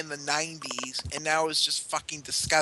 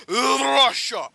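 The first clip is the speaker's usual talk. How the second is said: hammed-up Russian accent on "Russia", higher and louder; mock Russian; 7 semitones higher, 6 dB louder than usual. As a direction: boom "Russia" like a Soviet propaganda voice.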